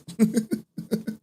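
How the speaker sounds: a short squeaky giggle; high giggle. Real, not acted. Laughing at himself over the mods, squeaky giggle. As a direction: break into a high little laugh. Laughing at his own remark.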